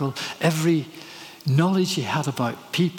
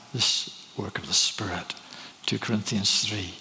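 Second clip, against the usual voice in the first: hushed whisper; 5 semitones lower, 5 dB quieter than usual. Real, not acted. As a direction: whisper it, low and soft. Hushed moment in the sermon.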